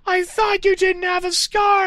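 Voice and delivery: absurdly high squeaky shriek; shrieked shout. A narrator's reading. Girl shouting at Vampire about his scar, very high shriek. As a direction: push it up into a squeal. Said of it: "I shouted".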